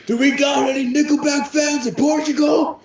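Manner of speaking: hammed-up rock singer shout. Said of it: "he's like".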